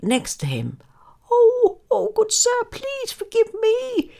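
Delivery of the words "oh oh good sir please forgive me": very high, pleading little squeak; squeaky mouse. A narrator's reading. Trapped mouse begs the lion's forgiveness, high squeak. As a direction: squeak it like a frightened tiny mouse. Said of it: "he squeaked".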